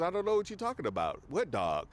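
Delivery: feigned innocence; calm Lex. Retold or imitated. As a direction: brush him off fast in a lightly voiced Lex. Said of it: "Lex is very calm".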